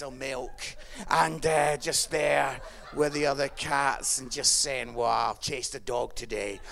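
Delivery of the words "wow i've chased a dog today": low, laid-back cat voice; boasting pub cat. Acted. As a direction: brag casually in a low put-on voice.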